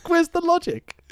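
high, incredulous laugh. Retold or imitated. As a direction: laugh in disbelief. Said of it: "They're like".

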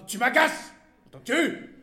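irritated. A narrator's reading. Wife rebuffs husband in story.

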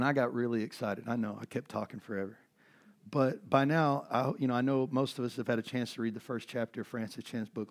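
ordinary talk, nothing marked

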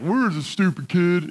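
lightly put-on crewman's voice, drawn out; imitated coworker voice. Older coworkers looking for the missing kid, lightly imitated voice. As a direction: imitate an older workmate talking about the kid. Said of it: "that's how they really talked".

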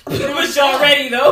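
laughing shout. Real, not acted.

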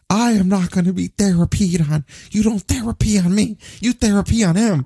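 high, whiny indignant rant; Gargano rant. Retold or imitated. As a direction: mimic an outraged wrestler refusing therapy. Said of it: "Every Gargano segment".

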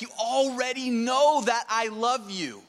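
high, drawn-out insistence; slow emphatic exasperation; frustrated. Retold or imitated. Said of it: "is he frustrated because he's like".

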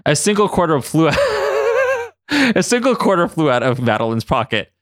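ordinary delivery

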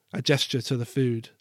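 ordinary delivery, nothing marked

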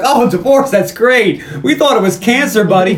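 high, loud, relieved bro voice; cheerful Bulldog. Retold or imitated. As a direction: cheer him up like a jock buddy. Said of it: "Bulldog's like".

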